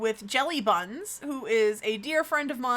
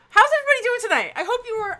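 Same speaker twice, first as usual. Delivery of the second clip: excited; 12 semitones higher, 9 dB louder, much faster than usual. Real, not acted. Rushed high greeting.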